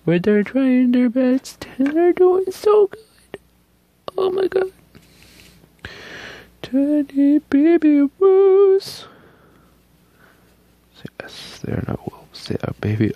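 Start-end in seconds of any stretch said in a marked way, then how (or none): 0.1-9.1 very high, loud, squealing adoration; high squealing gush. Real, not acted. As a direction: squeal with delight over cute animals. Gushing over baby wolves howling.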